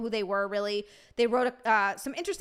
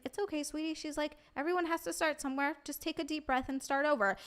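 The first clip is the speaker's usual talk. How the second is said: a soft, higher, kind voice imitating the actress; gentle reassurance; 4 semitones higher, 6 dB quieter than usual. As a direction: soothe a nervous newcomer lightly. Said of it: "And she's like".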